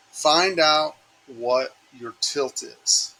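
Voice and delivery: very slow, each word weighed; slow it right down so it lands; slow emphatic. Shown as a demonstration. Teacher slowing down for the class, very slow and deliberate. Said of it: "I'm gonna pause for a second".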